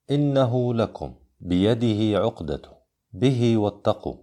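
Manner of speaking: measured examples, final vowels of "إنه", "بيده", "به" drawn out; short connecting madd. Shown as a demonstration. Teacher contrasts minor connection examples, "إنه" and "به" with lengthened endings. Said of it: "الصلة الصغرى".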